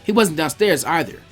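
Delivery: ordinary delivery, nothing marked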